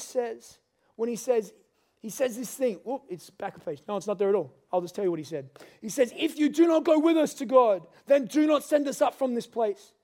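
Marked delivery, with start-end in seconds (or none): 6.2-9.9 pleading Moses. Retold or imitated. Preacher quoting Moses pleading.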